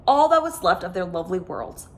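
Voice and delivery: usual speaking voice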